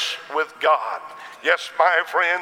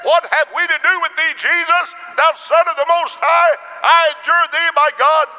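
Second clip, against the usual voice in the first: demon's shout, high and loud; loud cry; 12 semitones higher, 7 dB louder than usual. A narrator's reading. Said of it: "he cried with a loud voice and said".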